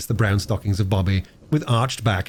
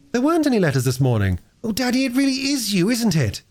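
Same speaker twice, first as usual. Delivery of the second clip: fast, then much higher in wonder; hardly believing her father is back; eager girl; 12 semitones higher than usual. Acted. Bobby overjoyed with father.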